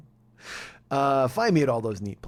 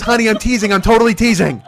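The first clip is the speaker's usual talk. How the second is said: loud, raised imitation of protesting wife; insistent protest; 6 semitones higher, 9 dB louder than usual. Wife telling husband she's teasing, insistent protest. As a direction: protest loudly over his outburst.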